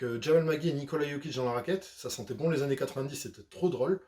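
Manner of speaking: usual speaking voice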